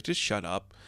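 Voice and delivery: a muttered, deflated brush-off; quiet grumble. Retold or imitated. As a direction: mutter it, annoyed. Mark grumbles at Reese.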